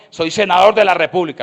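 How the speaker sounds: boastful senator's shout; arrogant loud boast. Retold or imitated. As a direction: throw your title at him. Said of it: "Me decía".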